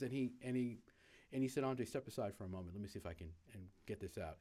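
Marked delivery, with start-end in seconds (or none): 1.6-4.4 quiet aside. Retold or imitated. Manager quietly takes over the grill.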